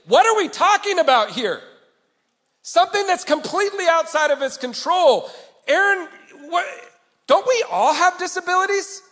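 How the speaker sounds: high, loud exasperated protest; angry objection at the funeral he wished he'd made; frustrated outburst. Retold or imitated. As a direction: stand up and challenge the speaker at the funeral. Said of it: "I wanted to stand up and say".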